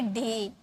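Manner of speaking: डे said slowly and clearly as English day; Hindi: डे को धीरे और साफ़ बोलें; slow correct day. Shown as a demonstration. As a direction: model डे slowly for the class. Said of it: "ये डे नहीं होते हैं".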